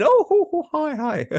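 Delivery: very high, hooting mimicry; mock greeting. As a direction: tease with a silly imagined hello. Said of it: "You've never once been".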